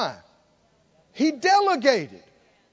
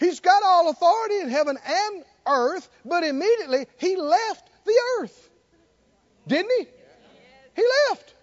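raised, high pulpit voice; impassioned preaching; 9 semitones higher, 3 dB louder than usual. Real, not acted. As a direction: press the point on the congregation. Preaches to congregation.

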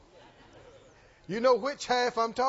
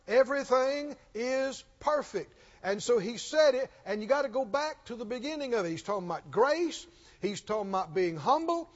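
ordinary talk, nothing marked

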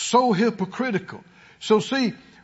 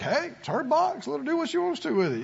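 shrugging indifference; 3 semitones higher, 6 dB quieter, faster than usual. Retold or imitated. Voicing someone who doesn't care.

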